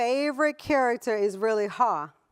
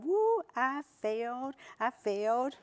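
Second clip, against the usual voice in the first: a light, cheery woo over failing; happy little victory cry; mock cheer; 4 dB quieter, slower than usual. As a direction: celebrate the failure playfully.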